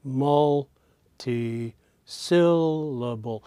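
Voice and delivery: "multisyllable" drawn out very slowly, one syllable at a time; syllable melody. Shown as a demonstration. Teacher demonstrating the word's melody, very slow "multisyllable", syllables separated. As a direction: stretch each syllable of "multisyllable" to expose its tune. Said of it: "three places where the voice goes a little higher".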